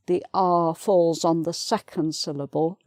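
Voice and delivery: normal talking voice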